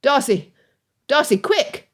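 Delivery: high, urgent call; shouting. A narrator's reading. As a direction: call across the track. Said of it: "Colonel Fitzwilliam shouted from across the track".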